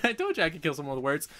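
ordinary talk

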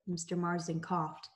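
ordinary delivery, nothing marked

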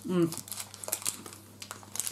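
mumbled through closed lips; talking with food in mouth; chewing while speaking. Real, not acted. Seen 1: Closed lips, moving cheeks after biting bar; speaks clearly by 2.0.

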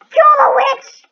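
loud excited shout. Real, not acted. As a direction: yell it out. Gamer yelling at witch in game.

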